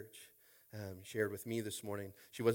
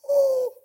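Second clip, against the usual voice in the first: very high, loud "ohh" cry; retold cry of amazement; loud high whoop; 17 dB louder than usual. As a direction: let out a whooping outburst. Reacting to God's reply, retold.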